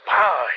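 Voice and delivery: loud, high exploding "pow"; imitated impact. Storyteller showing a tractor hitting the cop, explosive impact noise. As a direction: burst out a big crash noise.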